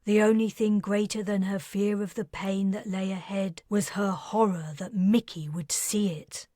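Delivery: ordinary delivery, nothing marked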